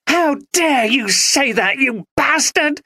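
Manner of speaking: high, slow, raging scream; wife screaming at her husband mid-row; furious scream. Acted. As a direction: scream it at him with hatred. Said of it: "she screamed".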